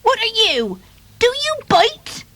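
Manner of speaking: very high little duckling voice; high-pitched, alarmed baby duck; crying duckling. Acted. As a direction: squeak the questions like a startled duckling. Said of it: "cried the duckling".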